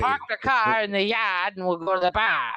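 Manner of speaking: broad mock Boston, dropped R's; Boston accent. Host imitating someone from Boston, in a Boston accent. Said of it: "with a Boston accent".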